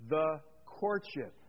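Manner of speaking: slow, drawn-out, said together with the church; deliberate call for everyone to repeat; slow unison recital. Shown as a demonstration. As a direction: lead the congregation saying it slowly. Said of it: "Let's say that together".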